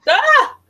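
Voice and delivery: high, loud cry of surprise; short, high startled "ah"; startled yelp. Real, not acted. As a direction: yelp at a sudden shout. Reacts to being shouted at.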